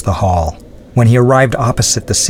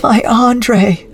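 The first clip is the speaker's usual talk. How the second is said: much higher, pleading cry; anguished cry; 14 semitones higher than usual. A narrator's reading. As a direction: call out to him in grief. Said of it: "cried Adrienne".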